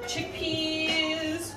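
ordinary delivery

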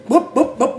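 high "bốp" hitting noises; imitated blows. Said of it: "Nó đánh bả vậy nè".

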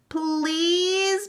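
put-on high, drawn-out child's plea; wheedling, pleading kids; begging. A narrator's reading. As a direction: beg in a high voice, stretching out "please". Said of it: "begged Meg and Sean together".

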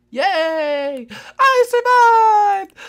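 high, slow triumphant squeal; squealing victory. Real, not acted. Player who survived the blast squeals in triumph. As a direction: shriek with joy at surviving.

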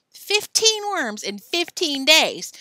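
high, loud, slow yell. A narrator's reading. Joe insists on the terms of the bet, loud yell. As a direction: hammer out each word loudly. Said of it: "yelled Joe".

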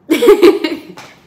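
loud, high burst of laughter; real delighted laugh. Real, not acted. Nurse amused she missed hearing nicu, laughing. Laughs at her own mishearing.

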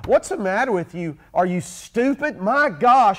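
hammed-up spouse lashing out; mock furious insult. Spouse insulting partner over underwear, hammed-up, loud and high. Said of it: "So this is what they do".

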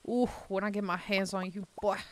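put-on Southern exasperation with a gusty "boy"; exhausted Southern scolding. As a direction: gasp out a weary Southern warning. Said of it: "that, like, exhaustion".

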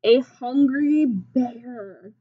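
normal talking voice

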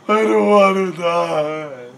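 slow, high wail of mock terror; hammed-up dying wail; drawn-out wail. A narrator's reading. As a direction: stretch it into a whiny cry. Voices panicked character's wail.

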